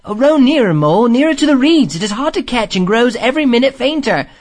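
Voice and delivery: high, loud, hurried urging; urgent plea. A narrator's reading. Rat urges Mole to row nearer, urgent. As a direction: beg eagerly before the sound slips away.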